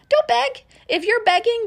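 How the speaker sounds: a preacher's loud, high warning against begging; emphatic outburst. Real, not acted. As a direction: burst out in loud, high insistence.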